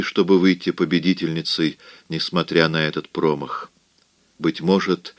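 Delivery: ordinary delivery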